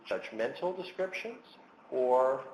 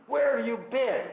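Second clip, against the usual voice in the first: sharp, much higher and louder accusing outburst; angry demand; 12 semitones higher, 6 dB louder than usual. Retold or imitated. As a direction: snap at the late wife as if furious. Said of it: "I might actually express a lot of anger".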